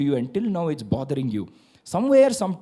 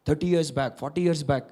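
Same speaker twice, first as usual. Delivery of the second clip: usual speaking voice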